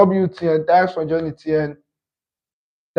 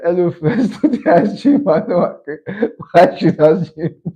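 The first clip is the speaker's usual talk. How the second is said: higher-pitched quote broken by giggles; laughing retold quote; 4 semitones higher, 4 dB louder than usual. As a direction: imitate them while cracking up. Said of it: "I'm sorry for laughing".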